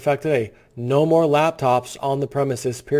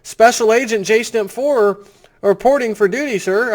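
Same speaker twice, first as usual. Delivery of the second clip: higher, stiff mock salute of an FBI agent; mock agent reporting; 8 semitones higher, 4 dB louder than usual. Host pretending to be an FBI agent reporting for duty, hammed-up. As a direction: snap to attention like a rookie agent. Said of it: "top-level F B I agent".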